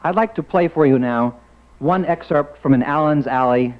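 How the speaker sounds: usual speaking voice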